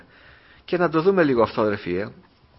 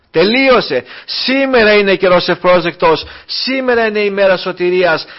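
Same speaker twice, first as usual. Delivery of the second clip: higher and louder urgent sermon climax; impassioned preaching; 5 semitones higher, 5 dB louder than usual. Acted. Pastor warning the brothers that today is salvation, impassioned preaching. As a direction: plead with the flock to repent today.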